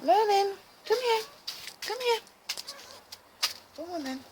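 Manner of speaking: high, sing-song call to a pet; calling the cat. Real, not acted. Calling her cat over.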